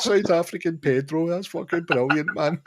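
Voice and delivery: high-pitched, tickled amazement; imitated delight. Retold delight at the name.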